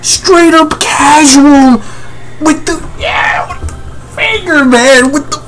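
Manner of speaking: very high-pitched disbelieving squeal; hyped freak-out. Real, not acted. Reader sees he's going finger, high excited freak-out. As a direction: squeal it, voice shot far up in disbelief.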